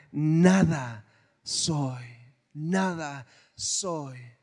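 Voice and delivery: very slow, low, dropping away; hushed, drawn-out ending; slow solemn close. Acted. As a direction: let nada soy sink slowly. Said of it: "Pablo decía".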